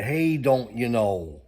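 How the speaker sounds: slow, drawn-out Minnesotan "don't you know"; light Minnesota accent impression. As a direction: lean into the Minnesota accent, slowly. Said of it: "That's what they talk like up here".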